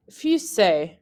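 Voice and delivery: "Fusée" ending in an "ay" sound; French pronunciation. Shown as a demonstration. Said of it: "words that use the accent aigu".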